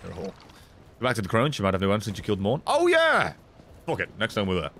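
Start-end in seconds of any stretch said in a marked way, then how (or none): 2.7-3.3 high excited cry. Real, not acted. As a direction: burst out with sudden glee. Sudden excited realization.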